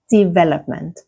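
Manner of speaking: development stressed on VEL; careful model development with the beat on VEL; second-syllable stress. Shown as a demonstration. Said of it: "stress is on the second syllable".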